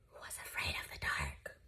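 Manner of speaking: hushed whisper on the dark; whispered, as if afraid of the dark. A narrator's reading. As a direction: breathe it like a secret. Bedtime story, scary dark.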